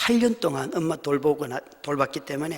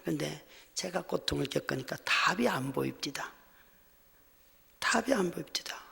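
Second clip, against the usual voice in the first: hushed admission; 8 dB quieter than usual. Real, not acted. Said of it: "repeated line hushed".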